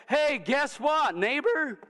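high, slow, loud calling out; excited calling. Acted. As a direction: call out to the neighbor. Freed woman calling to neighbors.

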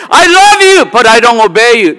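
high, loud sing-song claim of love; mock-sincere churchgoer voice; mock believer. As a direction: parody a fake believer's sweet words. Mocking a hypocritical believer.

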